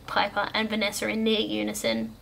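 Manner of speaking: usual speaking voice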